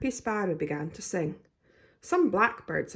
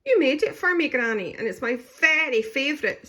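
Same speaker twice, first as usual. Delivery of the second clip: sobbing; 8 semitones higher, 6 dB louder than usual. A narrator's reading. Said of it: "sobbed Daisy".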